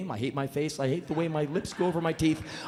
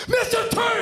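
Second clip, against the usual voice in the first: much higher, loud blurted shout; exploding greeting; 9 dB louder than usual. Retold or imitated. Nervous fan blurting at [name], loud explosive shout. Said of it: "it explodes from my mouth".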